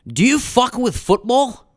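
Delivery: put-on loud, high Scottish accent. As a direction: ask it in a broad Scottish accent. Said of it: "in his Scottish way".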